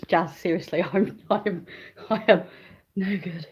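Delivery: ordinary talk, nothing marked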